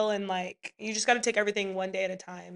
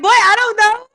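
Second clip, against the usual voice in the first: exasperated mom; 12 semitones higher, 13 dB louder than usual. Retold or imitated. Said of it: "I'm like".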